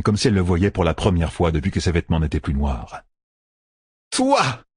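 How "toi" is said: very high-pitched accusing outcry; exclaimed cry. A narrator's reading. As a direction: cry out as you suddenly recognise the culprit. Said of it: "s'écria-t-elle". (exclamation accusatrice, très aiguë)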